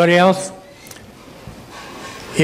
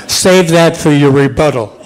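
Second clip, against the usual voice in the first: louder, through laughter; laughing retort; 5 dB louder than usual. Real, not acted. Moderator joking back at an audience member, laughing loudly. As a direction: call it out while laughing.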